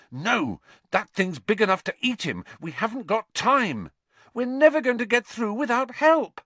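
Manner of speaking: growing panic, ending high; urgent protest. A narrator's reading. Harry refusing Hermione while Ron is dragged off, rising alarm.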